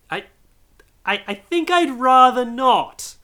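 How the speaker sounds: high, slow, hesitant refusal; stammering fear. Acted. Scrooge nervously refusing the spirits. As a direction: back away nervously.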